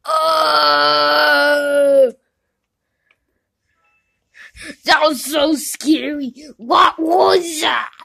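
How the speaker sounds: loud frightened cry, slow on what was that; scared. Acted. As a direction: yelp in fright after the jump scare. Frightened by a scary video.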